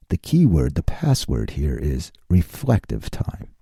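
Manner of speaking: slow deep mock-dramatic reveal; deep ominous voice. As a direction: announce it like a movie trailer.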